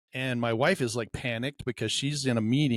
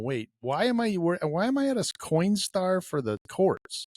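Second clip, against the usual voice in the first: puzzled; 7 semitones higher than usual. Retold or imitated. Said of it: "I'm scratching my head going".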